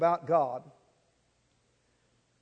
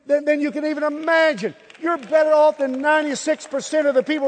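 fervent preaching; 6 semitones higher than usual. Real, not acted. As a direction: lift the congregation with force. Preaching at full force.

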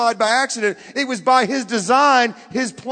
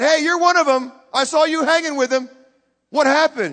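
loud, raised challenge; acted accusation; 6 semitones higher, 6 dB louder than usual. Bystander accusing a disciple, loud challenge. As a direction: call him out, high and loud.

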